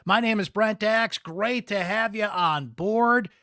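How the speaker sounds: high, loud, drawn-out welcome; show-opening host greeting. Real, not acted. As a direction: kick off the show big and loud.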